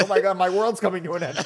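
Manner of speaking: high-pitched, quick mock alarm; mock panic. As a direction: play a kid's world collapsing, half laughing. Child seeing dad cry.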